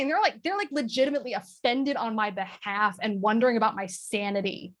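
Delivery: ordinary talk, nothing marked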